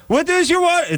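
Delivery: very high-pitched mock Israeli woman; Israeli neighbor accent. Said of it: "sixty-year-old Israeli".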